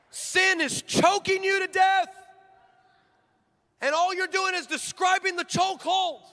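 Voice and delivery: preaching. Acted. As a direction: hammer it home from the pulpit. Preaching at full force.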